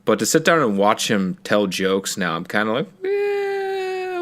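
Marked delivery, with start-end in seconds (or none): 3.1-4.2 very high, drawn-out "eh"; long squeaky noise of doubt; reluctant whine. Acted. Viewer unimpressed by the comedian's jokes now, reluctant whine.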